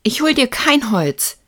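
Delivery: loud, high defiant refusal; furious shout. A narrator's reading. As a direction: yell it like an enraged child. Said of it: "schrie Lukas wütend".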